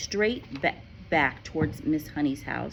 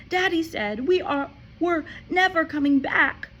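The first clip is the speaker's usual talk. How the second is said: put-on high, pleading child's voice; a child's distress; upset girl; 13 semitones higher, 6 dB louder than usual. A narrator's reading. Matilda explains to Miss Honey, upset.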